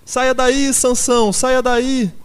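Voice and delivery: very high, loud crowd cry; frantic shouting. A narrator's reading. Animals urge Sansão out of the van, shouting. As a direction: shout frantically for him to escape. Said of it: "gritavam a um tempo".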